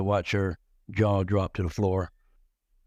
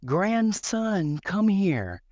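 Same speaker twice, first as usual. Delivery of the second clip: put-on high sing-song call from an old woman; grandmother calling; 11 semitones higher, much slower than usual. Grandma summoning her grandson at the family reunion, high calling voice. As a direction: call him over like a bossy grandma. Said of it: "As soon as I walk through the door".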